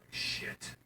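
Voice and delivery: unvoiced hushed curse; whispered curse. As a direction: whisper it, caught out. Whispered at hearing door.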